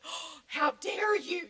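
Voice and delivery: high, loud, drawn-out affronted gasp; mock indignation. Dumb teenager to the boy who winked, mock indignant. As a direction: play up the outraged teenager.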